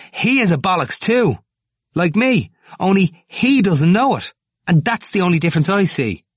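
put-on high, loud, agitated young student; Temple, excitable student, accusing. A narrator's reading. Temple declaring his rival just as bad, agitated. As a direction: hammer the accusation out at top pitch.

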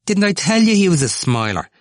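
high, loud triumphant question; excited crowing. A narrator's reading. Temple turning to everyone about the nickname, excitedly vindicated. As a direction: pitch it right up, loud and insistent.

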